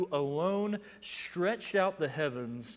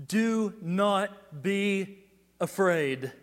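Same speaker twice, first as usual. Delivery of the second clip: loud proclamation; 4 semitones higher, 8 dB louder, much slower than usual. Acted. Loud proclamation of Jesus' words.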